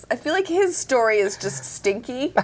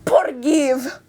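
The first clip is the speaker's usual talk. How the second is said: imitated Spanish-accented old man, higher pitched; mock little old man with a Spanish accent; broken English; 7 semitones higher, 3 dB louder, much slower than usual. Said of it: "in his broken English".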